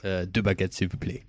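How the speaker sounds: warming up a French accent, a bit soft. Shown as a demonstration. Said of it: "I need to warm up the French accent again".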